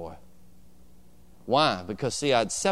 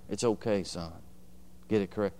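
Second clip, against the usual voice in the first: tender mother; 5 semitones lower, 7 dB quieter than usual. Retold or imitated. Mother comforting her son.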